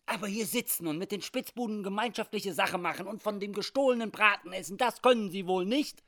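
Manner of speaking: high, loud accusation; indignant reproach. Acted. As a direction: scold him, building in volume to the final question. Schmidt angrily reproaches Hawkins.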